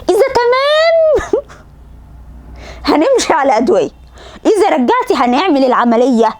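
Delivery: تمام stretched high and long, laughing through it; mocking the repeated plan. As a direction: drags out تمام in mock exasperation. Third repeat, exasperated mock. (سخرية من تكرار الخطة مع مط "تمام")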